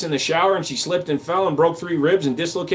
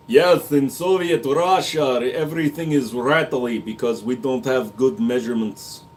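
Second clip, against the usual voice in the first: mock Russian accent; 2 semitones lower than usual. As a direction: play a Soviet joke in a put-on Russian accent. Joke about Soviet standard.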